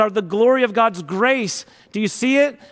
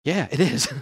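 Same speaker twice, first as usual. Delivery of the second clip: laughing aside; 5 semitones lower, 4 dB quieter than usual. Real, not acted.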